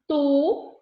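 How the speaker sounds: loud isolated "tu" for the class; tonic syllable stressed. Shown as a demonstration. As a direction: punch out the syllable "tu". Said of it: "que é a sílaba tônica".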